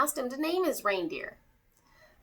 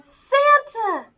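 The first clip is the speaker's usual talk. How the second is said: put-on high, loud, thrilled cry of a little boy; excited exclaim; 13 semitones higher, 9 dB louder than usual. A narrator's reading. Six-year-old boy spots Santa in his house, excited high cry. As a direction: burst out at the sight of him. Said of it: "The boy exclaimed".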